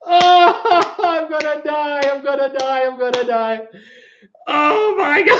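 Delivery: high gasp sinking into worn-out laughter; really dying of laughter, struggling to get words out; helpless laughing. Real, not acted. Host overcome by the silly quiz names, helpless laughing. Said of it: "I'm laughing so hard".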